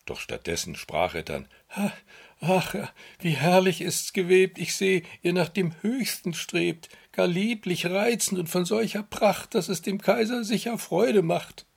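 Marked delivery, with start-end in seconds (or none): 1.7-11.7 fake praise. Minister fakes praise.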